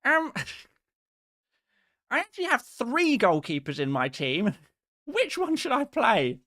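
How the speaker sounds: high, whiny pestering viewer; a whiny viewer begging, pitched high; annoying chat voice. Retold or imitated. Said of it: "That is the annoying voice".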